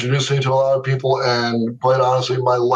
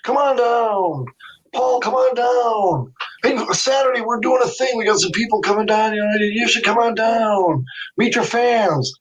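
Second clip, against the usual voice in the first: a hint of a persistent promoter, pitched up; pleading Doc; 8 semitones higher than usual. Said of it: "petitioned me almost six months".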